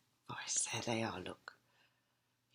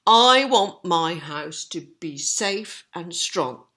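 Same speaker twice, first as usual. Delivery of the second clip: slow, steady and a bit louder; lightly voiced sensible pig; 3 semitones higher, 8 dB louder than usual. Percy resolving to build well, slow firm. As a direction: say it firmly to himself. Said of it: "he thought".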